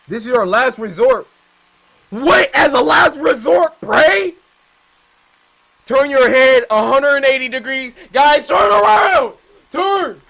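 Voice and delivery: high, loud frantic shouting; panicked shouting. Real, not acted. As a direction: yell frantically at the screen.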